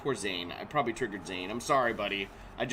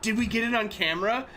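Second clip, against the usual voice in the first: put-on higher-pitched, eager child's question; imitating his kid; 9 semitones higher, 4 dB louder than usual. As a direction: lightly put on an excited kid's voice. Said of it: "he was like".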